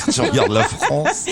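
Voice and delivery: laughing through the words; a real laugh at his own joke. Real, not acted. Blague sur la carte de France. (en riant de sa propre blague)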